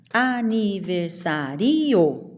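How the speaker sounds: "Aniversário" slowly as a-ni-ver-sa-RI-o; split final vowels. Shown as a demonstration. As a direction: lean on a separate ri. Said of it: "Eu não falo assim".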